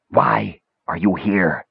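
slow, demanding ghostly voice; deadly demanding voice. A narrator's reading. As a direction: demand it as a deadly unseen mage. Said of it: "the deadly voice demanded".

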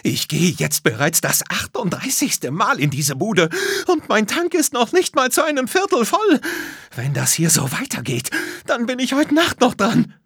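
high, exasperated woman's tirade; fed-up customer shouting; shouted complaint. A narrator's reading. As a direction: rant loudly, climbing in pitch. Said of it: "schrie eine Frau".

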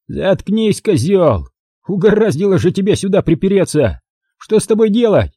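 high, scornful thug bark; irritated thug. Acted. Thug snaps at the intruder in a high scornful voice. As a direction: snap at the intruder.

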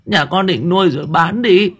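high, wailing peasant woman begging; wailing plea. A narrator's reading. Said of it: "mà gào lên".

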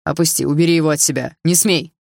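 loud alarmed shout. A narrator's reading. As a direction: yell at her to drop it. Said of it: "закричал он". (громкий испуганный крик)

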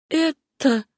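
high, imploring call of a name; pleading. A narrator's reading. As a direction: plead with her, pitched well up. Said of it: "умоляющим голосом произнесла Сюзанна". (умоляющим голосом)

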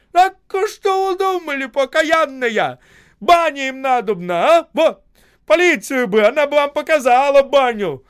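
high, very loud angry ranting; heated shouting. A narrator's reading. Осип berating the crowd, heated high shouting. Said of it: "А он кричал всё горячее".

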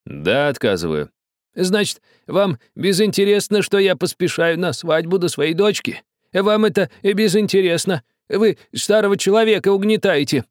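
high, loud reproach; aggrieved old man. A narrator's reading. Old man reproaching the captain, aggrieved and loud. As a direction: lay on the guilt.

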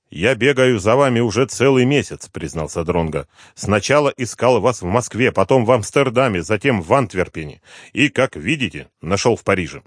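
lightly voiced steady detective; Дронго's calm voice. A narrator's reading.

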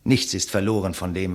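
usual speaking voice

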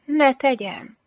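high-pitched, innocent asking back; coy question. A narrator's reading. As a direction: lift the voice high, as if asking permission. Teasing woman asks back.

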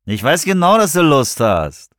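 cocky pressure, stretching the end; pushy insinuation. A narrator's reading. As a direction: goad her, cocksure. Man pressures girl from car.

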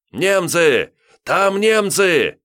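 panicked yell at the top of his voice; enemy spotted shout. A narrator's reading. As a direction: scream the warning, high and loud. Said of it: "заорал". (истошный крик о немцах)

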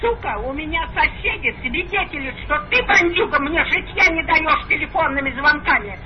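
ordinary delivery

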